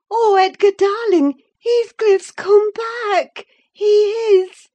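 very high, loud, ecstatic cry; wild excitement. A narrator's reading. Wife announces Heathcliff's return, wildly excited cry. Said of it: "too excited to show gladness".

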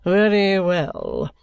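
slow, lofty acknowledgement; condescending. A narrator's reading. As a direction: say it slowly, talking down to him. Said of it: "with a certain condescending laugh he had".

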